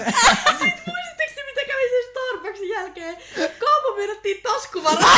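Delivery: high squealy giggle through the words, slowed down; can barely get it out for laughing. Real, not acted. Friend to friends, recalling the Prague mall, laughing hard.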